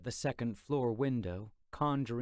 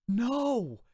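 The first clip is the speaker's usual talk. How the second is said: loud, much higher scared no; cry out; 12 semitones higher, 7 dB louder than usual. Acted. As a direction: shout it in terror. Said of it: "Tubb and I cried in unison".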